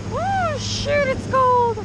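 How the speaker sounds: high, loud whoop in the cold; excited shout. Real, not acted. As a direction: shout it out, thrilled. Thrill ride, shouting out.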